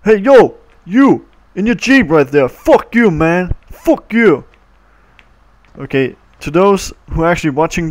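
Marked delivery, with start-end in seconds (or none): 0.0-4.4 high, loud mock rage at a Jeep; put-on yelling voice. Kid shouts at a Jeep driver in the game, put-on rage. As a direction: shout it in a silly cartoon voice. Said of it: "the voice right now".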